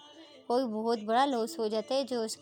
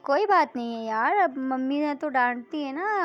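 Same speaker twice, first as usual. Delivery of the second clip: consoling; 3 semitones higher, 7 dB louder than usual. Acted. Consoling friend about scolding mother.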